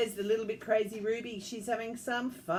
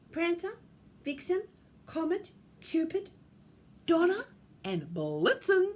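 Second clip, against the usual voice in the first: plain reading voice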